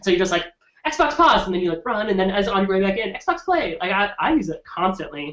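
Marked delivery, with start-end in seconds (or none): 0.9-1.5 high, loud call to a console; calling out command. Retold or imitated. Gamer dashing off to pee, calling out a command. 3.1-3.8 high-pitched call to a console; calling out command. Retold or imitated. Gamer on the way back in, calling out a command.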